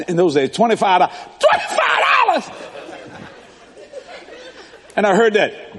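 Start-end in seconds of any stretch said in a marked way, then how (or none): 1.4-2.5 high, shouted disbelief; shocked. Retold or imitated. Teen hears the tow truck price, high shocked shout.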